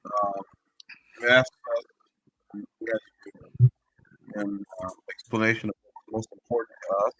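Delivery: muffled bad connection. Real, not acted. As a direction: sound like through a wall. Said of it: "you sound muffled".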